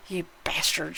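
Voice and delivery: soft, breathy mock insult; breathed. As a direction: breathe it fondly under the breath. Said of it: "she breathed".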